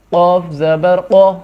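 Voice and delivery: heavy qaf drill. Shown as a demonstration. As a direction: say قو with a thick, full ق from the throat. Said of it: "قاف موٹا پڑھنا ہے".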